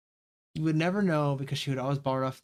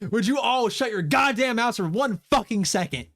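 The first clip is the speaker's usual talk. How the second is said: high, loud snapping roar; fed-up bellow at the squabbling group; furious shout; 8 semitones higher, 6 dB louder than usual. Acted. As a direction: lose it and shut them all up. Fed-up boy silences group.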